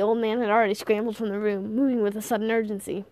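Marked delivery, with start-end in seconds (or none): none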